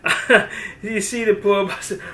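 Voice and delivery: talking through a laugh. Real, not acted. Laughing to the viewers about the poor chicken. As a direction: giggle over the poor hens.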